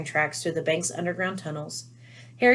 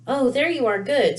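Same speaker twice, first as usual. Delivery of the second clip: breathless; 7 semitones higher, 5 dB louder than usual. A narrator's reading. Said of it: "She sounded breathless".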